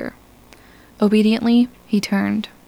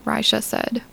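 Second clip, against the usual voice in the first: ordinary delivery, nothing marked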